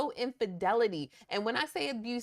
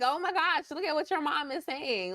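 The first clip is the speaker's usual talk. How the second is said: teasing schoolkid; 6 semitones higher than usual. Retold or imitated. A classmate teasing the sons about their mom, mock-gossipy. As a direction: rub it in like a classmate showing the clip.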